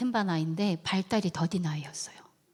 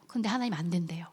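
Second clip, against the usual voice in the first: ordinary talk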